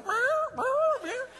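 very high mewing; tiny confused meows; kitten meow. Retold or imitated. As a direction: mew like a dazed kitten. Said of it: "He's just kinda going".

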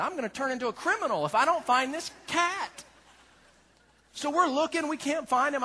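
usual speaking voice

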